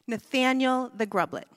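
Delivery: ordinary talk, nothing marked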